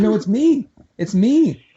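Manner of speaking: begging not to be reported, half in jest; mock pleading. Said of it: "I said".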